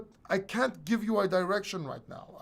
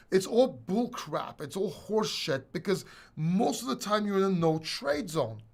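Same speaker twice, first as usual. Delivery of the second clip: ordinary talk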